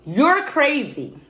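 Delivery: loud, high, drawn-out declaration; emphatic verdict. Real, not acted. Vlogger passing judgment on wild behaviour, emphatic verdict.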